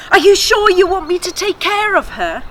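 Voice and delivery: high, loud eager question; eager boy. Acted. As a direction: burst out with boyish excitement. Boy asks, eager and excited.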